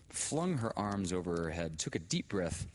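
usual speaking voice

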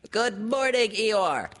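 high, loud squeaky little-creature shout; Piglet's high-pitched holler. A narrator's reading. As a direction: call out a greeting in a piercing little voice. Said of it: "shouted Piglet".

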